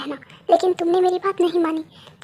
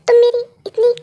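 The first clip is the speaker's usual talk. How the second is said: crying upset; 5 semitones higher, 5 dB louder than usual. Acted. Upset at ugly painting, crying.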